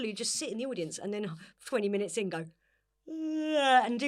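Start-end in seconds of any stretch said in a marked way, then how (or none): 2.4-3.8 high loud mock groan of boredom.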